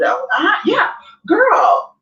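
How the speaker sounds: high, drawn-out teasing. Retold or imitated. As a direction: egg him on playfully. Mocking sexy encouragement.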